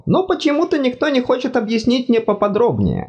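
high fussy god voice, apologetic; embarrassed god. Acted. God speaks.

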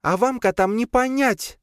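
high, huffy brush-off; dismissive. A narrator's reading. Lea brushes off the cat's question, dismissively. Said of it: "отмахнулась от него Лея".

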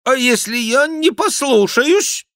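high, loud, slow defiant question; openly dissenting, drawn-out query; note of disagreement. A narrator's reading. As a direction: challenge the voice, pitched very high. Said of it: "придав своему голосу явственную нотку несогласия".

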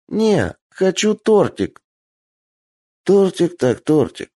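high, slow insistent whine; stubborn little girl. Acted. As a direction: dig in like a spoiled child. Child insists on cake.

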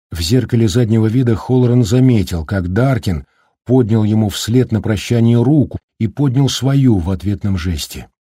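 ordinary delivery

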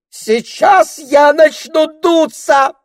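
very high, slow, loud squeal; a touchy snake squealing a threat to sulk; shrieking snake. Acted. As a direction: squeal it like a spoiled diva. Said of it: "взвизгнула Дульчебелла".

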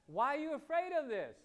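high challenging retold demand. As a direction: press the question hard. Confronting demon in retold story.

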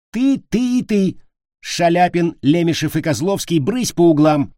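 high, loud, emphatic orders; furious command. Acted. Teacher sends three culprits to corners, furious. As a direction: point out each culprit, then banish them.

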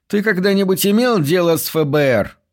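high squeaky captain voice; a thin, high pitched boss. A narrator's reading. Captain's voice held.

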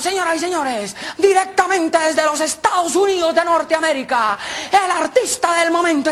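high, drawn-out master-of-ceremonies build-up; show announcer. Acted. Announcer introduces the artist from the States, show announcer. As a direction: build up to the star's entrance. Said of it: "el animador".

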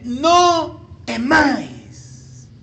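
very slow, loud, each word stretched; solemn proclamation. Acted. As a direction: declare it high and spaced out. Jesus's words proclaimed slowly.

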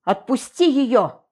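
slow, loud menacing command; stern shout. A narrator's reading. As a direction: bark the order sternly. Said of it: "грозно крикнул".

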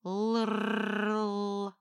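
put-on a small boy rolling a growly R; child growling R. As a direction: growl the letter like a kid. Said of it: "зарычал".

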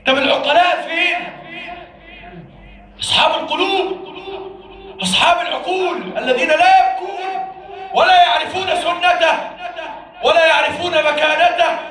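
loud, high, fiery sermon cry; preaching at full force. Real, not acted. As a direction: thunder at the congregation like a preacher. Sermon climax, rebuking the listeners.

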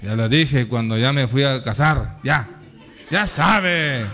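low-pitched, gruffly brushing it off; dismissive husband. Acted. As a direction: play the husband who won't repeat himself. Husband shrugging off the advice. (marido que le resta importancia, voz grave)

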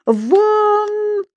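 very high, long-held cry; angry shout. A narrator's reading. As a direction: yell him out, drawing the word long. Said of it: "побагровев от гнева, закричал старший преподаватель".

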